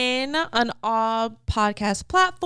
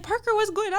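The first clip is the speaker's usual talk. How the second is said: mock worried mom; 9 semitones higher, much faster than usual. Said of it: "I'm like".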